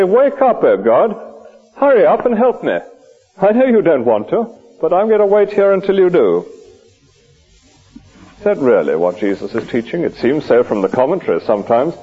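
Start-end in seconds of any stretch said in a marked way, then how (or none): 0.0-6.5 impatient demanding prayer. Pretend. Mock impatient prayer to God.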